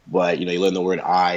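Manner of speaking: usual speaking voice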